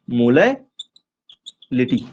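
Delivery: मोलालिटी very slow, split as "mola... lity"; word broken up slowly. Shown as a demonstration. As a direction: stretch मोलालिटी with a long gap before "lity". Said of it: "मोलारिटी नहीं". (मोलालिटी को बहुत धीरे, तोड़कर बोलना)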